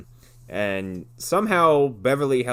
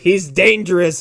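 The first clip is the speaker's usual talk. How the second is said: loud, higher, hammed-up cop; mock dramatic warning; 6 semitones higher, 8 dB louder than usual. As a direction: overplay it like a movie cop's threat. Retold cop's zinger threats.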